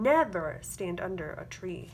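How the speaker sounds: ordinary delivery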